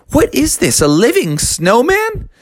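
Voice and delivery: surprised. A narrator's reading.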